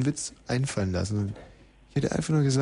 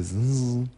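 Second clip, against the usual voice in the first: light machine whirr. Retold or imitated. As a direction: imitate a cassette machine's faint buzz. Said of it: "Es macht das Gerät dieses leichte".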